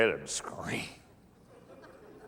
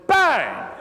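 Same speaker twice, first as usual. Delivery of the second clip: mock gunshot; 9 dB louder than usual. Shooting the wounded preacher.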